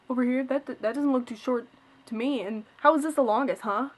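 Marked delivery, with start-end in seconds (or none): none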